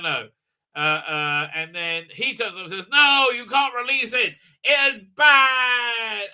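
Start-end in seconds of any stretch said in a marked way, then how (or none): 2.9-6.3 high, loud melodramatic shout; hammed-up forbidding cry; mock urgent warning. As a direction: imitate a panicked warning. Voices man forbidding her.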